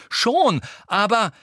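high, loud attempted interjection; heated protest. A narrator's reading. Harry trying to answer Ginny's tirade, heated protest. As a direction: push back loudly before being cut off. Harry cut off in shouted quarrel.

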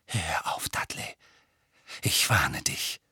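whispered warning; soft, voiceless threat; quiet. A narrator's reading. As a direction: warn him under your breath. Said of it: "sagte Harry leise".

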